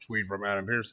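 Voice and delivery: ordinary talk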